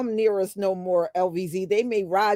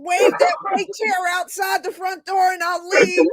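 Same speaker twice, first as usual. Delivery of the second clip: laughing mock missionary; 10 semitones higher than usual. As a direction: push the line through a laugh. Repeats missionary line laughing.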